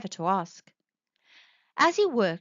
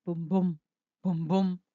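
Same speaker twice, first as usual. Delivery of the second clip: low thudding booms; 4 semitones lower than usual. A narrator's reading. Heartbeat sound of knight.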